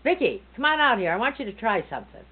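loud high call to come outside. Retold or imitated. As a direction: call her out eagerly. Uncle calling her outside.

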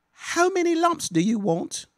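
high mock-posh English accent, slow; prim English tea-party lady, drawn out high. To his little daughter at a Peppa Pig tea party, hammed-up English accent. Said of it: "do it all in an English accent".

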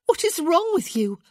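high, loud, urgent question; alarmed demand. Acted. Shocked at his sickness.

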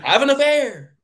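high, loud drawn-out holler; mock shout. Podcaster hollering his joke advice for Weidman, mock shout. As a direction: shout it out like a rallying cry.

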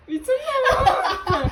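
high squealing laugh through words; laughing hard. Real, not acted. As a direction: crack up mid-sentence. Friend's scary face while laughing.

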